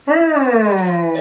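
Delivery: a high, loud, wide-open "Oh!"; the frog reacting to Missus Cow, wide-mouthed "Oh!". A narrator's reading. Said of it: "that's the way they talked".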